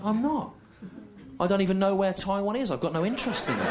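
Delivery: indignant denial. Retold or imitated. Defending himself against the anti-Taiwanese accusation, indignant denial. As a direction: deny it hotly, voice pitched right up.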